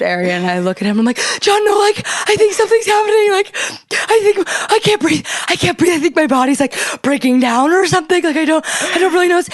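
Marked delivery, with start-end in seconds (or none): 1.4-9.4 very high, breathless mock panic; hammed-up panicky squeak; faked hyperventilating. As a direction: gasp it out as if faking a panic attack. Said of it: "I'm hyperventilating so hard".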